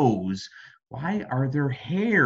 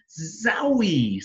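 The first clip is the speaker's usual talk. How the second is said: excited zowie; 7 semitones higher than usual. Acted. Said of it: "said Ada".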